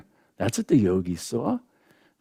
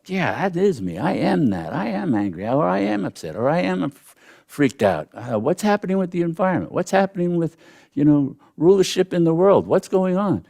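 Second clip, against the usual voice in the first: a hint of a worked-up inner voice, quick and higher; mock agitated ego mind; 7 semitones higher than usual. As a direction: lightly act out the mind getting swept up, higher and faster. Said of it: "they really grab our sense of".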